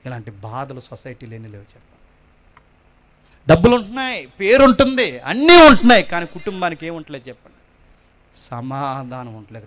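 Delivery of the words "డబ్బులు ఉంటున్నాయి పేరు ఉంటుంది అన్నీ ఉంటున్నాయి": building sermon. Acted. Rising preaching.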